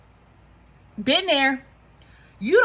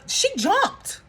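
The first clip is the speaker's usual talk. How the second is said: normal talking voice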